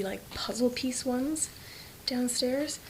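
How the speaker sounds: slow secret whisper. Real, not acted. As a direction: hush it so he can't hear. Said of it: "I have to whisper".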